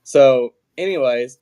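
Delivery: ordinary talk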